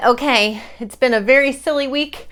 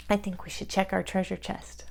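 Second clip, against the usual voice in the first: usual speaking voice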